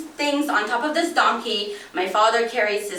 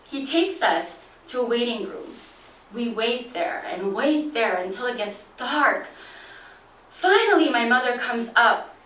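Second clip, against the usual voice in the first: ordinary delivery, nothing marked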